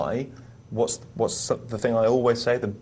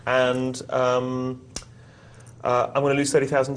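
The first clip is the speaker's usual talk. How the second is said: Stephen Fry impression; 3 semitones higher, 4 dB louder than usual. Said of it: "I turned him into Stephen Fry".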